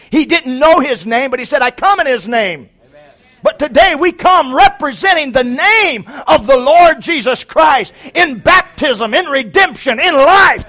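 high, loud, shouting preacher; preaching at full force. Acted. Preacher crying out the name of the Lord to his church, shouting. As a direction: thunder it to the congregation.

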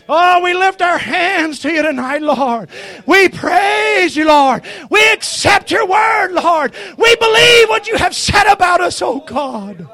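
loud, crying-out praise to God; fervent prayer. Real, not acted. Preacher lifting hands to the Lord, fervent praise. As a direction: call out to heaven, falling at phrase ends.

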